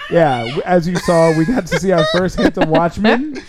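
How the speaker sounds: ordinary delivery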